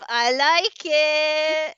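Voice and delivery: stretched slow and loud, teasing; slow teasing drawl. Acted. Host teases unprepared co-host, slow and loud. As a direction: savour it slowly to tease her.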